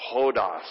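"Hodos" spoken in unison, falling; saying hodos together. Shown as a demonstration. Pastor leading the congregation in saying "Hodos". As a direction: chant "Hodos" with the room. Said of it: "One last time".

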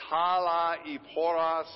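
talaiporos said slowly, syllable by syllable; talaiporos, each syllable clipped apart and drawn out; staccato. Shown as a demonstration. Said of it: "Let's say it one more time".

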